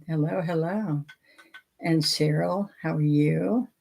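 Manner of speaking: ordinary delivery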